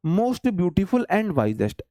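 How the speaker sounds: most beautiful and wisest, word by word, very slowly; teacher reading a board example slowly; slow dictation. Shown as a demonstration. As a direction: spell the wrong example out slowly for the class. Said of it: "ये वाला क्यों गलत?".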